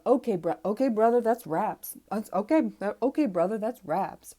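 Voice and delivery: mocking a contestant's put-on street accent, loud. Host mocking Sam M's fighting bro voice, loud mock accent.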